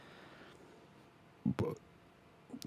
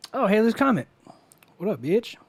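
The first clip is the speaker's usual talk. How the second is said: excited greeting; 9 semitones higher, 7 dB louder than usual. Real, not acted.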